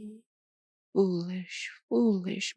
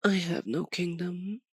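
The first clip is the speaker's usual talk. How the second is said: normal talking voice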